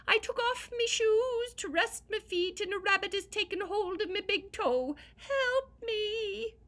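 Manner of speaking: very high, loud pleading cry; crying little man. Acted. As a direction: squeal for help like a tiny man in pain. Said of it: "cried the little man".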